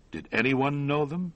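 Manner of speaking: ordinary delivery, nothing marked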